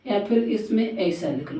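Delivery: ordinary delivery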